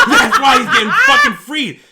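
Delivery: high, very loud, laughing shout; excited outburst. Real, not acted. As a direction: yell the joke revelation through laughter. Bursts out at a joke realization.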